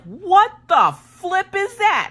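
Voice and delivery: very high, slow, drawn-out shriek of alarm; mock horrified squeal; shocked disbelief. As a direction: stretch it out, high and appalled. Hearing husband's knee crack.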